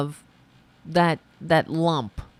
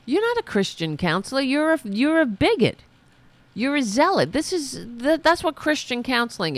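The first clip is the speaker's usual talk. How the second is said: high-pitched, scolding accusation; indignant outburst; 9 semitones higher than usual. Real, not acted. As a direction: tell the bigot off in outrage. Berates the woman in clip.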